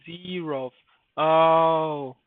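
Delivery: ordinary delivery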